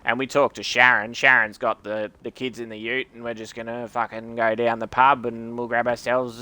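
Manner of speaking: broad Aussie bogan-style voice; broad Australian accent. Shown as a demonstration. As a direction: lay the Australian accent on thick, like a pub regular. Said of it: "a different Australian voice".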